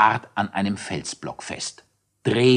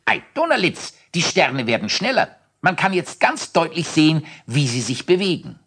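excited call; 8 semitones higher than usual. Acted. Thaddäus calls out excitedly at the speeding stars. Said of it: "Plötzlich rief Thaddäus".

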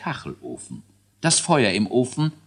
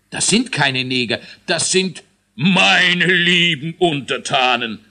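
king shouting; 7 semitones higher, 6 dB louder than usual. A narrator's reading. King shouts at the dwarf about his sooty subjects. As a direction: bellow at the blackened crowd. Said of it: "schrie der König".

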